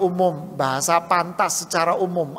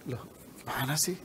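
whispered, puzzled aside; 4 semitones lower, 13 dB quieter than usual. Real, not acted. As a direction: drop to a whisper, baffled. (berbisik bingung)

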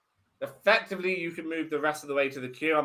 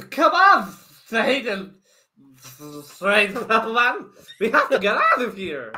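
higher, louder comic put-on accent; Sasha the duck's accent; 4 semitones higher, 8 dB louder, slower than usual. The duck urging little Thrain to flee, in a loud comic accent. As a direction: belt it out in a silly foreign accent. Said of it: "I'm laughing at my own accent".

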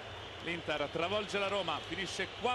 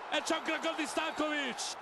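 breathless italian goal commentary; 7 semitones higher, 3 dB louder than usual. Real, not acted. Goal call in live commentary.

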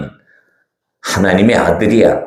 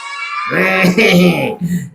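high shouted mocking cackle; lying devil laughing at the audience; devil's cackle; 7 semitones higher than usual. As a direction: cackle down at the kids.

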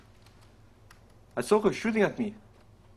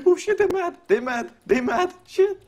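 much higher and louder, yelping in alarm; panicked excitement; 7 dB louder than usual. Real, not acted. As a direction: shriek it as they come for you. Chased by angry players.

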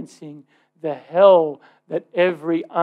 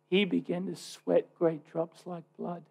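much softer, hushed and grave; sudden hush; 10 dB quieter than usual. Real, not acted. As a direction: drop the voice to a near hush. Sudden drop after climax.

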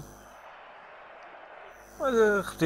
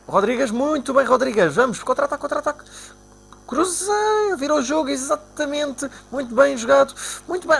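thrilled; 11 semitones higher than usual. Real, not acted. Scoring chance in the game.

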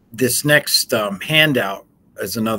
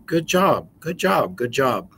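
chirpy, higher automatic good job; mock adult praise; 5 semitones higher, 5 dB louder than usual. Adults praising children on autopilot, mock-chirpy higher voice. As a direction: rattle it off like a distracted parent. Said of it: "how much you hear adults say".

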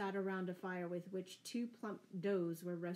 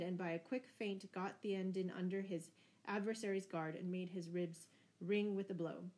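ordinary delivery

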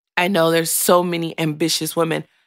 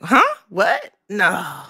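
disbelieving huh; slower than usual. Retold or imitated. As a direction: mimic people's confused disbelief. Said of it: "a lot of people are like".

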